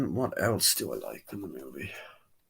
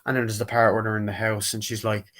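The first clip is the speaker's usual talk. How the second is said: ordinary talk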